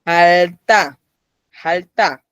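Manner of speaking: jalta with a hard, unaspirated T; tensed T. Shown as a demonstration. Teacher showing that tensing happens here, jalta with tense T. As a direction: press the T in jalta, no puff of air. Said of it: "Ahí sí dio".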